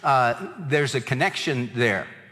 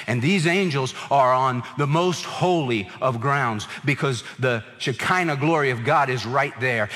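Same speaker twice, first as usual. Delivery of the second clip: normal talking voice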